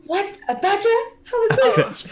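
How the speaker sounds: high, startled disbelief; shocked. Acted. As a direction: gasp out the question in shock. Character shocked by badger.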